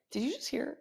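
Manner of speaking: quiet, startled question; hushed. Retold or imitated. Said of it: "talking to my client".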